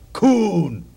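loud, threatening call of the name; sudden hostile bark, falling at the end; menacing shout. Retold or imitated. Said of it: "say it in that tone of voice".

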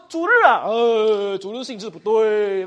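loud, drawn-out disapproving objection; mock objection. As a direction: contradict them outright, loud and slow. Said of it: "你不要跟他说是". (大声拖长反驳)

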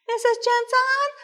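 very high, tiny questioning voice; high little voice calling a patient's name. Retold or imitated. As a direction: put on a small chaplain's high-pitched voice.

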